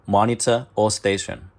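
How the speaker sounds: ordinary talk, nothing marked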